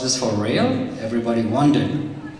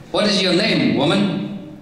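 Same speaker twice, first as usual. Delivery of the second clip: higher-pitched imperious question; demanding; 7 semitones higher than usual. A narrator's reading. Queen questions the kneeling village woman, demanding. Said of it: "demanded the queen".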